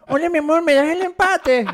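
high, slow mock baby voice; high pleading baby talk to a lover. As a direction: beg sweetly in a baby voice. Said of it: "estás hablando como un chingo".

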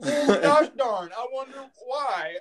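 high, drawn-out sarcasm; mock wondering. Patient answering why his bladder isn't full, sarcastic. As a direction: play dumb with exaggerated, high-pitched puzzlement. Sarcastic retort to doctor's question.

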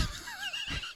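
squeaky tickled giggle; high-pitched burst of laughter. Real, not acted. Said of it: "that has tickled me so much".

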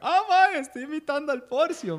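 high, loud imitated reaction; mock audience delight. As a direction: voice an easily impressed fan. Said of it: "ya la gente dice que".

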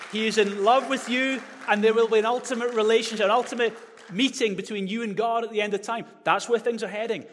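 passionate preaching. Acted. Preacher telling the congregation God loves them, loud and high. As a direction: drive it home with fervour.

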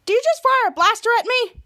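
high-pitched acted outrage; indignant demand. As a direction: demand it in imitated disbelief. Retold outraged demand at Jasper.

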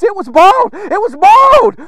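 high, loud cries of dismay; really upset. Acted. Upset prophet crying out over the axe, wailing. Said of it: "He, he's really upset".

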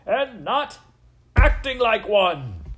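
high, very loud, drawn-out finish; furious shout. A narrator's reading. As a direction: bellow the rebuke, stretching the last words. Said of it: "shouted the very".

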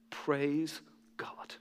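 breathy whisper of thanks; whispered praise. Real, not acted. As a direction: whisper it to God alone. Quiet aside after statistic.

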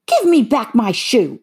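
put-on high, loud angry girl; angry demand. A narrator's reading. Dorothy demands her shoe back from the witch, high and angry. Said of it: "grew angry and said to the witch".

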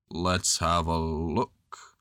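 low, slow, mournful donkey greeting; gloomy old donkey voice; gloomy Eeyore. A narrator's reading. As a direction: say it like nothing will ever be good.

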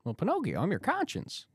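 high, light cricket voice; Jiminy Cricket. Retold or imitated. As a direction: squeak it out like a little cricket. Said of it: "You do a Jiminy the Cricket impression?".

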